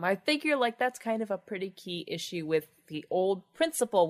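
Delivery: usual speaking voice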